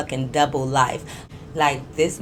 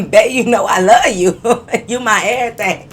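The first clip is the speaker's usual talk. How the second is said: louder sweet-talk broken by laughter; can't get through the cheesy line for laughing; laughing mock quote; 4 semitones higher, 8 dB louder than usual. Laughing at his comment to his "sister", mock sappy. Mocking the man's comment again.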